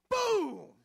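loud, high shouted boom with a laugh; crash boom. Retold or imitated. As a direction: bellow the crash noise, laughing. Imitated crash of truck.

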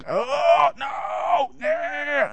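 high, drawn-out cry of protest; hammed-up howl of resistance; mock wailing refusal. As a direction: wail it like a balking child. Acts out resisting God's work.

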